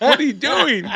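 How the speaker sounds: high-pitched mock mom asking a question; imitated mom. Son imitating his mom questioning seconds, mock incredulous. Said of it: "She was like".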